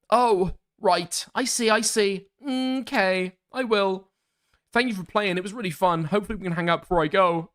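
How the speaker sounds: high, polite texting character; message writer's voice. Acted. Streamer voicing a goodbye text, high put-on voice.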